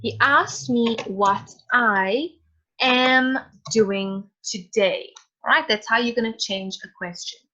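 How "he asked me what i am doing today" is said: He asked me what I am doing today, powoli, słowo po słowie; slow dictation. Shown as a demonstration. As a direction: dictate it slowly, stressing asked. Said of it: "this is how it's gonna look".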